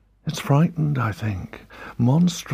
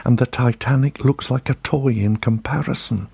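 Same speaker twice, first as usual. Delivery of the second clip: plain reading voice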